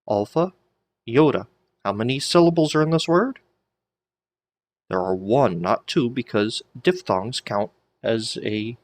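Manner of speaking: ordinary delivery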